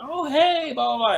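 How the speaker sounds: imitated high, airy-light hello; high-pitched greeting. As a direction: mimic an excited greeting way up high. Imitates Kim's greeting at rehearsal.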